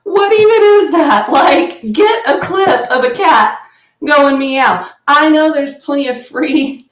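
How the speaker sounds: loud, high-pitched disbelief; amused exasperation. Real, not acted. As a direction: rant incredulously through laughter. Mock outrage at anime's fake meow.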